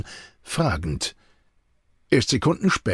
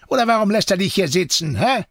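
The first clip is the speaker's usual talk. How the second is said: quick, raised-pitch taunt; needling challenge; 8 semitones higher, faster than usual. A narrator's reading. Deserter taunts the captive left behind, needling. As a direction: needle the prisoner fast. Captor needles his bound prisoner.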